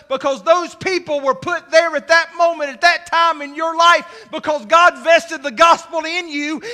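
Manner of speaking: loud, driving sermon delivery; full-force preaching. Real, not acted. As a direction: preach it hard, stretching out key words.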